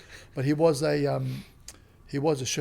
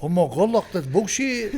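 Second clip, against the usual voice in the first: mock angry outburst; 4 semitones higher, 6 dB louder, slower than usual. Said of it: "they get, like, angry".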